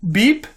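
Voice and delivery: high mouth beep; electronic beep. Acted. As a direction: chirp a short beep. Computer beep sound.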